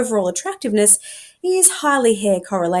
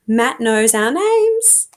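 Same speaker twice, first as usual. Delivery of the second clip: slow swoon; 3 semitones higher, 4 dB louder, much slower than usual. Acted. Swooning, hand on heart.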